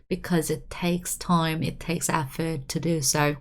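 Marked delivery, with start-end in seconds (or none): none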